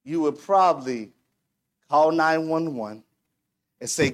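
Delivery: ordinary talk, nothing marked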